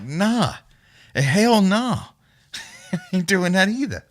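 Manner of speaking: high-pitched mock refusal; acted refusal. Partygoer waving off offered pills, high-pitched refusal.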